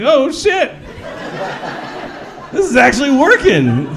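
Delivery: high, laughing "Oh, shit."; delighted it works; amazed glee. Retold or imitated. Amazed his line works.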